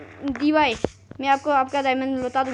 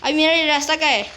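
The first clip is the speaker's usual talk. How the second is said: louder, demanding where the way is; frustrated loud question; 4 semitones higher, 7 dB louder than usual. Real, not acted. Lost player loudly demanding the way out, frustrated. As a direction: ask loud and exasperated.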